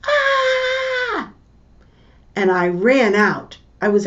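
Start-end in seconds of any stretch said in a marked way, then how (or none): 0.1-1.3 high sustained scream of pain; a child's pained scream; retold scream. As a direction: cry out as if hurt. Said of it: "and then a scream".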